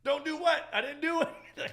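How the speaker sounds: high, loud mock outrage breaking into laughter; indignant protest. Scolded bartender denies everything to boss, mock indignant. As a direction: play up loud, laughing innocence.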